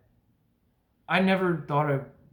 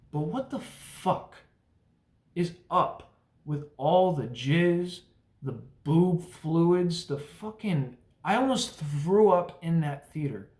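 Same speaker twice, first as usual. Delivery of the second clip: disgusted; 4 semitones higher, slower than usual. Real, not acted. Disgusted rant about gross film.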